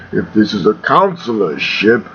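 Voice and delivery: low, menacing villain challenge; deep and ominous, doubting the captive; Darth Vader. Acted. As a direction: do a deep-voiced dark lord interrogating. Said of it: "eh, Vader impression".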